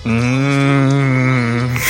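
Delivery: ordinary talk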